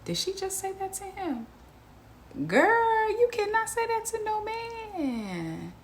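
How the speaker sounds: high, scandalized gasp of a question; mock-scandalized girl, way up high and slow; shocked disbelief. Vlogger reacting to a shocking clip, high scandalized disbelief.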